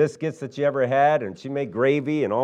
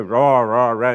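imitating a mumbled, mushy Southern voice; marbles in mouth; 3 semitones lower, 3 dB louder than usual. Pastor mimicking how King talked, with a mouthful-of-marbles mumble. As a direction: mush the words as if your mouth were full. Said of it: "sounded like he had marbles in his mouth".

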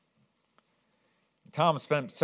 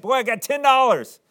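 much higher and louder, gleeful; excited boy; 11 dB louder than usual. Acted. Boy who finally has ten dollars, gleeful high cry. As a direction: burst out like a kid who found the money. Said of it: "He was all excited".

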